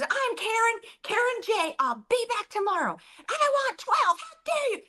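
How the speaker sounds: high-pitched, indignant customer caricature; mock an irate customer, pitch climbing to a shriek; Karen. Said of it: "She goes".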